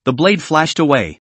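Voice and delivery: plain reading voice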